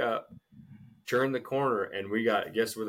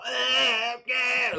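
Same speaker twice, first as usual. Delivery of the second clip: high, loud strangled cries; imitated choked scream; 6 dB louder than usual. Retelling the wailing girl at Walmart, loud high strangled cries. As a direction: mimic a kid being choked. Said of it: "like she's getting choked out".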